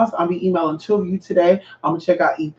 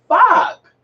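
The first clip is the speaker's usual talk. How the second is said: retold frustrated outburst; 14 semitones higher, 5 dB louder than usual. Frustrated retold self-quote.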